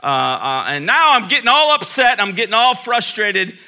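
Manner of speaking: high, loud worked-up inner rant; mounting frustration. Retold or imitated. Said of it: "I'm thinking".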